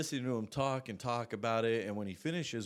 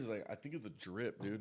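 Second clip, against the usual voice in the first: ordinary talk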